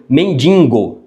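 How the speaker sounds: nasal slip, "mendigo" with an extra n before the g; pronúncia errada de mendigo. Shown as a demonstration. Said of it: "vícios de falar coisa errada". ("mendigo" com n intruso, mendingo)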